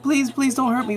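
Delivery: high-pitched mock plea for mercy; frightened plea. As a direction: play the scared doctor begging, lightly acted. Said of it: "He's like".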